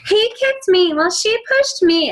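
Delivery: put-on tattle shrilly like a squabbling kid; squabbling siblings mock-whining at a parent; whiny kid. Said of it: "they gotta have the whine to it".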